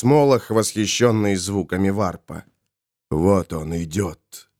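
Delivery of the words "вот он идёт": low, slow, ominous announcement; hushed low villain voice; entranced Molokh. Acted. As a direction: draw it out low and slowly.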